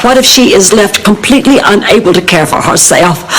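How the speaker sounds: usual speaking voice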